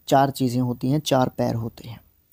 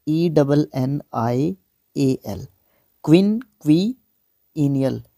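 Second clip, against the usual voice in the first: slow letter-by-letter spelling of क्विनक्विनियल, each syllable separated; spelled out syllable by syllable; slower than usual. Shown as a demonstration. Teacher spelling क्विनक्विनियल slowly for students, letter by letter. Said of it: "मैं syllable में तोड़ने के चक्कर में".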